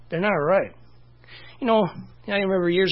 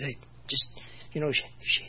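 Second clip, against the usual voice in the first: hushed aside; 3 semitones lower, 8 dB quieter than usual. Retold or imitated.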